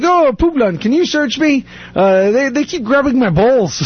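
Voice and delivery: high-pitched imitation of a Turkish friend's accent; Jacob's accent. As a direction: do Jacob's accented, put-upon complaint. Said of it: "The way he said it was hilarious".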